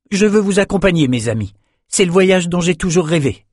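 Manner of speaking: high, earnest youth's voice; youthful eagerness for the journey; eager young man. A narrator's reading. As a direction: declare it keenly, pitched well up.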